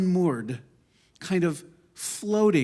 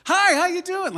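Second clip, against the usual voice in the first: much higher, louder chirpy hello; eager porch greeting; 8 dB louder than usual. Retold or imitated. Speaker greeting strangers from her porch during covid, eager and high. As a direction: call out to passers-by, hungry for company. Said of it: "my family would make fun of me 'cause people would walk by and I'd go".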